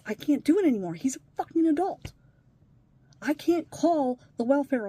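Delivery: rising into a loud, exasperated shout; she's fed up and lets it out loud; angry outburst. Real, not acted. Venting about the stepson who won't call, real anger.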